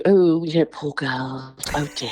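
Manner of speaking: mock pity, head tilted. Imitating people who pity the blind girl.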